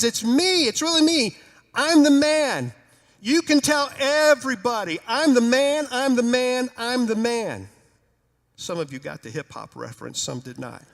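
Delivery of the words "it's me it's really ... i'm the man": loud, high, thrilled declaration; loud chanted boast, overjoyed; 1 joyful insistence. Acted. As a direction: crow it out like a hip-hop hook. Healed man insisting, hip-hop chant.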